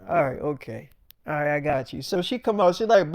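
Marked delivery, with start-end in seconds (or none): 0.1-2.0 low, soft giving in; retold meek agreement to calm a friend; placating. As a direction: back down softly. Placates his ranting friend.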